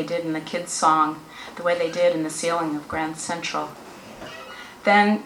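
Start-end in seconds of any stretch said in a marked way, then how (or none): none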